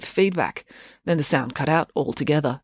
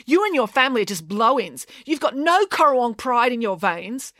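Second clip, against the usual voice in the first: higher-pitched haughty insult; indignant squabbling, pitch raised; scornful retort; 7 semitones higher than usual. A narrator's reading. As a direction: look down on the newcomers. Matilda's quarrelsome retort.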